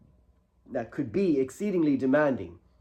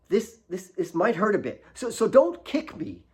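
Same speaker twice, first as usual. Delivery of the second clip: higher, hesitant warning; nervous boy; 7 semitones higher than usual. A narrator's reading. Alex about to clean Kate's knee, nervous. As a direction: stammer a cautious warning.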